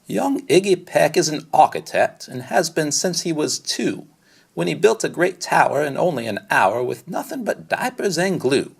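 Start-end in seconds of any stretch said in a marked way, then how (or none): none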